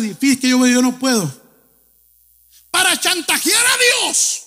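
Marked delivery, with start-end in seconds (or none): 2.7-4.4 raised, high shouted line. Real, not acted. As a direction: cry it out to the whole church. Preacher shouting to congregation.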